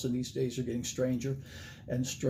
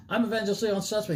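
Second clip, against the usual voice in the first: put-on rattled off fast, higher, in a Southern accent; fast southern drawl; 7 semitones higher, faster than usual. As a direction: rush the name out like a young Southern preacher. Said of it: "talked really fast back in those days and had a southern accent".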